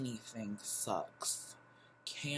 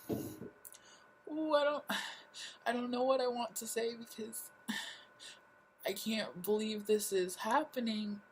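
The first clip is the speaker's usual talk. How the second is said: high-pitched, overwhelmed disbelief; retold distress; 10 semitones higher than usual. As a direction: let the voice climb high, lost for words. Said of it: "And I was like".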